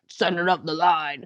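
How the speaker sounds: hammy hushed phone-call parody; imitated stilted phone call. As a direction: imitate bad acting on a phone line. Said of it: "does a terrible phone call".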